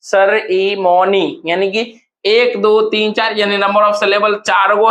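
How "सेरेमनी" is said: सेरेमनी very slowly, in separate syllables. Shown as a demonstration. Teacher repeating for students to count, सेरेमनी very slowly split up. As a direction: space out every part of सेरेमनी. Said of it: "सेरेमनी को क्या कहते हैं".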